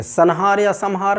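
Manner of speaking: "संहार" said first as san-haar, then as sam-haar; wrong nasals. Shown as a demonstration. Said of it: "नहीं बोलते". ("संहार" में पहले न, फिर म)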